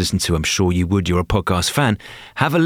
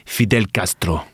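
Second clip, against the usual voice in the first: put-on Cuban accent; 3 semitones lower than usual. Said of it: "Cuban accent isn't a hundred miles different".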